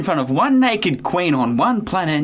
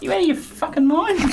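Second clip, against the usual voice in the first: outraged; 12 semitones higher than usual. Acted. Blooper outburst at the offer.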